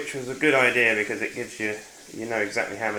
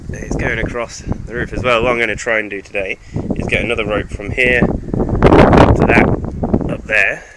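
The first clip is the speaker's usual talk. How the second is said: loud, raised voice outdoors; shouting over wind; 11 dB louder than usual. Real, not acted. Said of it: "you can hear me over the wind".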